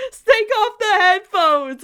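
high, loud, slow, strained shout; yelling at the screen. Retold or imitated. Friends yelling at Roddy Ann to take off the headphones, very loud. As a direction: beg at the top of your voice. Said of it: "we're just yelling".

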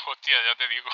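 laughing through the words; giggling while answering; stifled giggles. Real, not acted. Laughing at the joke.

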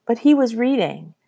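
ordinary delivery

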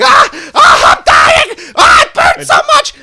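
loud fake crying yell of burning pain; a kid overplaying agony on the way out; hammed-up dying wail. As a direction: wail like holy water is killing you. Fake agony while dragged out.

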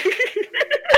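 shrill burst of laughter; high squealing laugh. Real, not acted. Laughing at own Garfield story.

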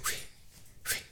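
"oui" said on an indrawn breath; inhaled "oui". Shown as a demonstration. Showing how the French agree, inhaled "oui". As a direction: suck the word in as agreement. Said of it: "para adentro y es como asintiendo".

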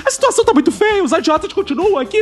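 high, whiny panicked friend; desperate friend voice. Retold or imitated. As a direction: plead like a friend in trouble. Imitando áudio da amiga desesperada. (amiga desesperada, voz aguda)

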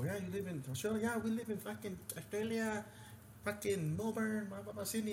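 high-voiced retold exchange; imitated bar chat. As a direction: pitch it up and rattle off small talk. Acting out chat with Australians.

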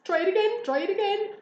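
high, loud encouragement; excited cry. A narrator's reading. Goose urging pig to dodge, excited cry. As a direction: egg them on loudly as a goose. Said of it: "cried the goose".

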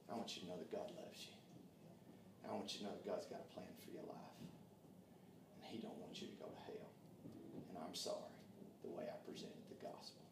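soft, low, compassionate re-enactment; gentle apology. Retold or imitated. Preacher re-enacting his apology to the mechanics, soft and loving. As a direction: drop to a hushed, humble near-murmur. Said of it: "I didn't say it with love and compassion".

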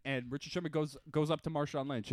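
usual speaking voice

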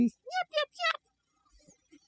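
very high tiny yips; cartoon puppy chirping; squeaky puppy voice. A narrator's reading. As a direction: yip like an excited little puppy. Puppy answers the children.

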